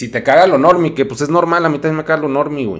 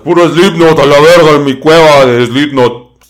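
mock metalhead; 3 semitones higher, 11 dB louder than usual. Mocking a [name] fan.

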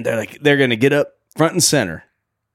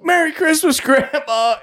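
put-on high, sing-song little girl voice; lightly voiced little girl, much higher; sweet little girl. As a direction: chirp it like an eager young girl. Little Trudy greeting grandma.

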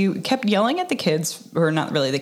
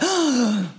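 mock gasp; 7 semitones higher than usual. Reacting to a movie twist.

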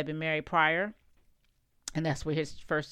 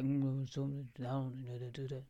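mumbling cartoon bear dad; 3 semitones lower, 7 dB quieter than usual. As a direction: grumble like a cartoon father bear. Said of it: "he would mumble when he talked".

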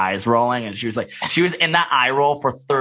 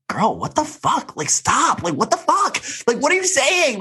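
much higher, disbelieving shriek; shocked outburst. Retold or imitated. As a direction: screech in disbelief at her. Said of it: "Kyle was like".

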